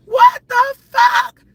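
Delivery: slow, high, loud exclamation; drawn-out, incredulous yell; shocked outburst. Real, not acted. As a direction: shout each word in disbelief.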